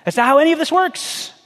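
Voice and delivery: mock-annoyed ad correction; commercial spokesman. God correcting us like the insurance ad, exasperated. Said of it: "insurance commercials where you've got somebody".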